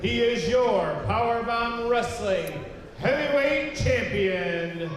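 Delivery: usual speaking voice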